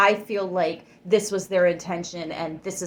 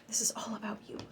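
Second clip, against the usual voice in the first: soft breathy whisper; 2 semitones higher, 12 dB quieter, much faster than usual. Real, not acted. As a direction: lean in and whisper it to them. Intimate aside to viewer.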